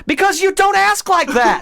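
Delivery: high, strained comic indignation; worked up into a squawk of disbelief; exasperated outburst. Real, not acted. Exasperated rant at absurd dialogue.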